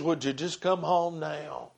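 low, soft entreaty; hushed pleading. Acted. Preacher pleads before a congregation, low and hushed. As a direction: plead gently, dropping right down. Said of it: "pleads and says".

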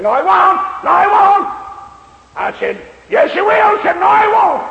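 high, loud, anguished protest; a drunk breaking down, shouting back at the preacher; desperate crying denial. Retold or imitated. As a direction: cry out against it.

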